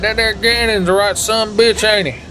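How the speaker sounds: high country-bumpkin twang. Pretend. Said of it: "I'm like".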